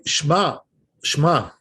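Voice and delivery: "Shema" slow, swallowing the eh into "Shma"; "Shema" with the first vowel dropped; wrong vowel. Shown as a demonstration. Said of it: "This is no good".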